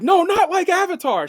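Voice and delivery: high, loud insistent no; exasperated correction. Retold or imitated. Scientists correcting the girl, high and loud insistent. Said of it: "And they're like".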